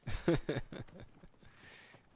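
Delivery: high-pitched laugh. Real, not acted. Streamer amused by pizza debate, high-pitched laugh.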